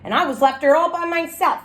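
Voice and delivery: usual speaking voice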